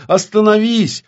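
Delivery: high, loud cry to stop; screaming. Acted. Witch orders the reflection to stop, screaming. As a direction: scream it as a desperate command. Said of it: "закричала ведьма".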